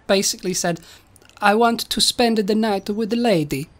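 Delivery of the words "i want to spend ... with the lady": mock-Italian robot voice; stagey Italian with added vowels. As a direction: declare it in a cartoon Italian accent. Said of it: "stereotypical Italian accents".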